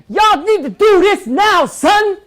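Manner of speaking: high, loud demanding yell; hammed-up pushy yell at the press; aggressive shout. Shown as a demonstration. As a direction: barge in and shout orders at them. Demoing aggressive approach to media.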